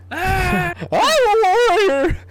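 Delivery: very slow, high, loud battle cry; booming defiant gladiator. Retold or imitated. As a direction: roar it out like a crowd. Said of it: "And they're like".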